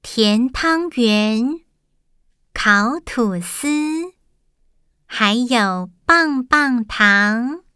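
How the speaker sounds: slow, careful word-by-word reading; slow teacher reading. Shown as a demonstration. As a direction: read each word slowly for children. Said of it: "现在老师念一句".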